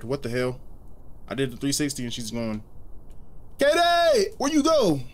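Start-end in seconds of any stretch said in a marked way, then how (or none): 3.6-5.0 put-on high, loud call for a lost girl; calling out for Katie, who vanished. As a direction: shout her name up high, searching.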